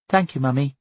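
put-on high, compliant little girl; polite child's high voice; Bertie. A narrator's reading. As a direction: say it sweetly to please mother. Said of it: "her compliance made her mother pleasant to her".

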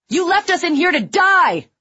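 loud, high anguished shout. A narrator's reading. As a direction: scream the blame at them. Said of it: "shouted another".

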